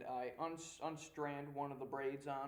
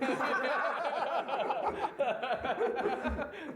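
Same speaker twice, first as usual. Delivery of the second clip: loud high laugh; 11 semitones higher, 12 dB louder than usual. Real, not acted. Big laugh at DM.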